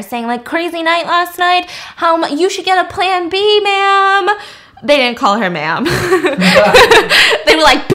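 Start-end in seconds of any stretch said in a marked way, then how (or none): 0.4-4.3 high, mocking catcaller; catcalling. Construction dudes to woman walking home, mocking catcall. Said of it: "construction dudes who are catcalling her". 5.9-7.4 laughing. Real, not acted. Speaker amused at her own censoring, real laughter.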